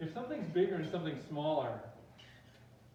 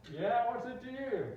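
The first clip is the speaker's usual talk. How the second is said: high, loud, slow challenge; defiant back talk; 8 semitones higher, 6 dB louder than usual. Acted. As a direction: talk back to the cop, hammed-up. Student talks back to cop.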